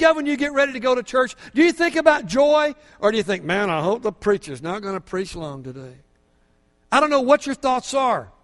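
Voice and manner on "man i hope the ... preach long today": low, soft grumbling aside; reluctant inner thought. Retold or imitated. Churchgoer dreading a long sermon, low and soft. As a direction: mutter it like a bored churchgoer.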